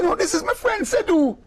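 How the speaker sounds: high, insistent correction. Retold or imitated. Clive insisting to the lady it is his friend, high.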